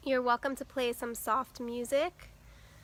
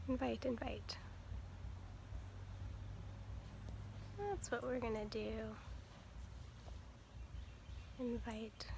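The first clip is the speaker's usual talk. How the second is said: very soft, half-whispered self-talk; absent-minded near-whisper; muttering to herself; 12 dB quieter than usual. Real, not acted. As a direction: think aloud under your breath while busy. Self-talk while finding invite button.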